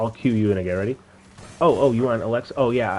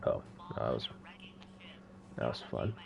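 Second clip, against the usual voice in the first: whispering; 9 semitones lower, 14 dB quieter than usual. Real, not acted. Quiet aside while playing.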